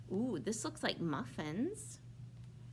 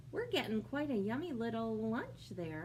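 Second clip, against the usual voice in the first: usual speaking voice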